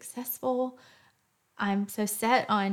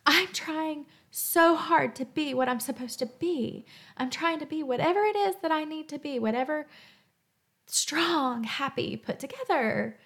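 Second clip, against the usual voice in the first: strained inner voice; 6 semitones higher than usual. Acted. Inner voice trying to cope.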